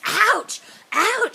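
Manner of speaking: high, loud mock "ouch"; sharp acted cries of pain; pained yelps. He is forced to feel the tree's pain, mock pained yelps.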